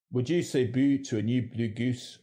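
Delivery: Scottish accent with fronted "goose". Shown as a demonstration. Presenter showing regional vowels, in Scottish. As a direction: say it like a Scot.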